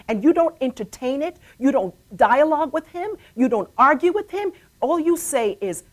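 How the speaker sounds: loud, emphatic testimony, pitch often lifted; impassioned preaching. Real, not acted. Mother urging listeners to stand on God's promises, preaching forcefully. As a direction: drive each phrase home like a preacher.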